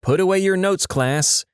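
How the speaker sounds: a hint of teacherly smugness; smug teacher. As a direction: sing it out a little, like a self-satisfied teacher. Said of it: "teacher has a smug look on their face and says".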